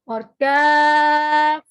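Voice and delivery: "کاف" slow and light; thin letter drawn out. Shown as a demonstration. Teacher drawing out the thin "کاف". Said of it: "یہ باریک ہے".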